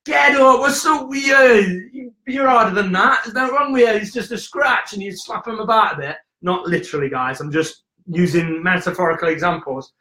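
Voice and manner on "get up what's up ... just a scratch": tough-love parent. Retold or imitated. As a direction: brush the kid off like a no-nonsense parent. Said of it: "if you just say to 'em, like".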